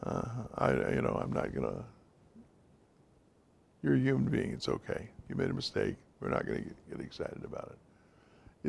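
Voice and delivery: soft, gentle reassuring words fading to a near whisper; hushed and kind, letting the mistake go; calm reassurance. Shown as a demonstration. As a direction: soothe her quietly, no blame. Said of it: "you have to say".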